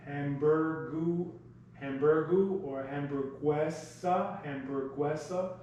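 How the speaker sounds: hamburguesa built up slowly from hamburgu; sounding it out. Shown as a demonstration. Teacher slowly sounds out hamburguesa for class. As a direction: stretch hamburgu, then land hamburguesa. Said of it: "In Spanish, it's pronounced".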